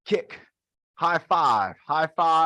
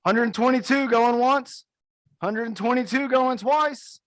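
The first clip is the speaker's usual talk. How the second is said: auctioneer call; 9 semitones higher than usual. Pretend. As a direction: drag out the final call like an auctioneer. Closing the mock auction.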